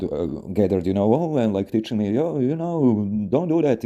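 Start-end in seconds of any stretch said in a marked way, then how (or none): 2.1-3.8 neighbour lecturing. Retold or imitated.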